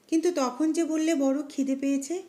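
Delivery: plain reading voice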